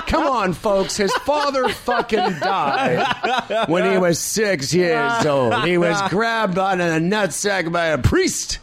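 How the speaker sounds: high, drawn-out announcer parody; stage MC. Acted. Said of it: "if I was M Cing".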